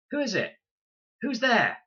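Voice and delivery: put-on high, loud anxious girl's voice; frightened call. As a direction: call out into the dark, afraid. Scared girl calling out.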